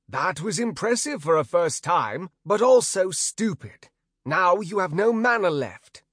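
a teacher telling off a rookie; gruff trainer voice. Acted. Trainer scolding the new mage.

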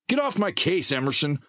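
high, sharp snap at a friend; snapping. A narrator's reading. Merrill snapping at Emerson over the lost map, high irritated snap. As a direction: bite his head off. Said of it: "Merrill snapped".

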